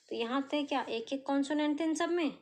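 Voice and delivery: ordinary delivery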